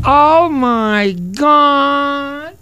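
high, slow, drawn-out gasp of Oh my God; Sandy Dennis impression. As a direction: stretch it out breathlessly like a stunned starlet. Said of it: "Nobody said that better than Sandy Dennis".

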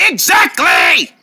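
drawn-out yell. Acted. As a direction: holler it slowly in agreement. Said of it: "Why she yell like that?".

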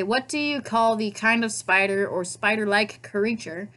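ordinary delivery

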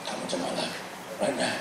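soft, low imitation of praying; hushed prayer. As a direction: murmur it like a new convert praying. They're praying.

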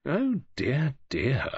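a mild, theatrical sigh in words. A narrator's reading. David answers Mary's scolding, sighing. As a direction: sigh it at her fussing. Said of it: "David sighed".

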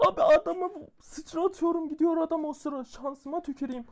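high, fast ranting about the luck; agitated rant. Real, not acted. Gamer rants to viewers about his bad luck, agitated.

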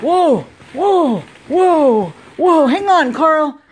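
loud, playful whoa cries; on a bumpy road. Pretend. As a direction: ride the waves with him. Pretend ocean ride for toy.

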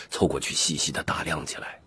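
plain reading voice

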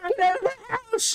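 panicked yell. Pretend. Senile granny yelling.

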